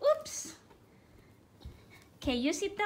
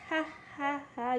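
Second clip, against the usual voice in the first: normal talking voice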